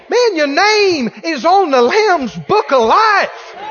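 high, loud preaching shout; rousing shout. Real, not acted. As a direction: fire up the church.

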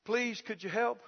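quiet, meek begging for help; soft, pleading mock beggar; fawning plea. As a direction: imitate a cowering beggar. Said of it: "they fall down and they go".

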